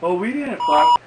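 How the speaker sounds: loud high protest; front-seat couple denying it, loud and high. Retold or imitated. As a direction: squeak out a loud denial. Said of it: "it goes".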